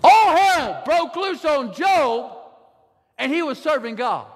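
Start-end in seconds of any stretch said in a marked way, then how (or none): 0.0-2.4 slow preached shout. Acted. Pastor returning to Job's story, slow and shouted. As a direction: let the line land like thunder.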